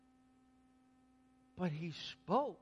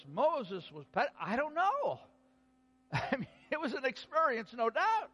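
much higher and louder, bubbling over; excited; 9 semitones higher, 7 dB louder than usual. Real, not acted. Preacher thrilled sharing the passage with the congregation, excited. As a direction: gush with delight, laughing.

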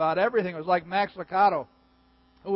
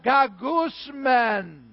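very slow, whining gagusman; drawn-out groan; 4 semitones higher, much slower than usual. Pretend. Meat not hot enough, long whining groan. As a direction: moan gagusman long and sulky. Acted complaint about cold meat.

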